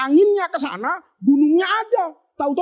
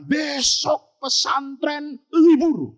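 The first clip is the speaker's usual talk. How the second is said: normal talking voice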